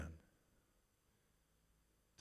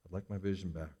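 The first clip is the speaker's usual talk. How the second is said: softer plea; 7 semitones lower, 5 dB quieter than usual. Acted. Bartimaeus repeating his plea to Jesus, hushed. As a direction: lower and softer, almost tender.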